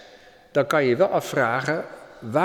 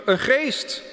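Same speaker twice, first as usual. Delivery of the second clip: frightened cry; 12 semitones higher than usual. A narrator's reading. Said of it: "schreeuwden het uit van angst".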